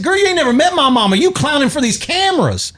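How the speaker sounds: high, fast mock scolding; scolding man. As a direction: rattle it off in a high voice. Retold Cops arrest argument.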